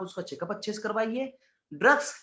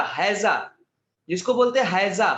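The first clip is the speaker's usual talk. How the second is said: ordinary talk, nothing marked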